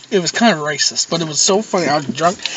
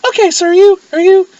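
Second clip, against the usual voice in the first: put-on much higher, louder girlish questioning; girly high voice; 12 semitones higher, 7 dB louder than usual. Girls at a party questioning a drunk guy, high and girly. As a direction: squeak it out like a confused girl. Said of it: "and they're like".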